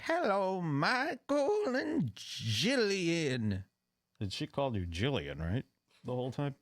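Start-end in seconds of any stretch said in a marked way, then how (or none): 0.0-3.6 slow, drawn-out, higher official greeting; judge's greeting. Retold or imitated. Judge greets the couple by name, slow and drawn out. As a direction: stretch the names out like a ceremonious judge. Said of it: "she says".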